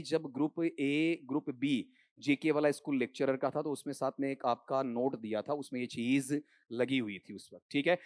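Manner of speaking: ordinary talk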